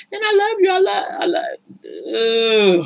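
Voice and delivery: high, drawn-out mock love; mock loving man. Cheating man pleading love, drawn-out mock high. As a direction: stretch the love falsely. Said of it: "he's telling her".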